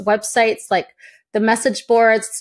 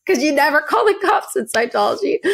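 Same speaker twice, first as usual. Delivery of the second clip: higher, sly retort; mocking the recruiter; 5 semitones higher than usual. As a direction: quote the recruiter with a smirk. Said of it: "the guy's like".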